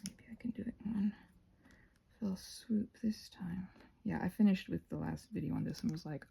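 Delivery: soft unvoiced whisper while working. Real, not acted. Crafter whispering to viewers mid-task, whisper. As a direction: hush it down to a breathy whisper.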